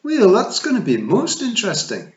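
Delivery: put-on high, loud sarcastic pixie girl; sarcastic jab at the rival; a hint of a sneering daughter. Fionn sneers at Tiffany.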